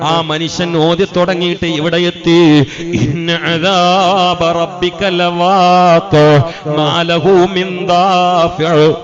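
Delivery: a drawn-out, rousing sermon chant; full-force preaching. Acted. Chanted sermon tells Umar story.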